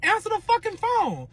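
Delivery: high shouted order; mock furious shout at boyfriend; retold yell. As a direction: bark it at him, furious.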